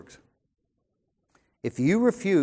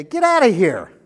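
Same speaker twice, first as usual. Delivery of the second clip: sharply louder, higher retort; wife rebuffing her husband, raised voice; wife pushing away; 6 semitones higher, 12 dB louder than usual. Retold or imitated. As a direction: push him off with your voice. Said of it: "she would push me away and say".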